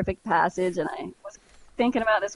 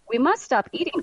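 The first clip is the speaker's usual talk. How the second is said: higher, mildly urgent storybook cry; lightly acted protest; 5 semitones higher than usual. A narrator's reading. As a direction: read it as a mild exclamation of alarm. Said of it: "cried Toad".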